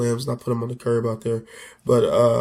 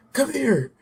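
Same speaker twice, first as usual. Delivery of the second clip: higher-pitched mock call from another room; retold yell; 11 semitones higher than usual. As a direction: call out urgently, without real volume. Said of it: "she's yelling".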